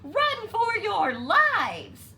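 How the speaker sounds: high, loud, slow yell; screaming warning. A narrator's reading. Chicken Little warning the birds to run, loud. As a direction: yell for everyone to flee.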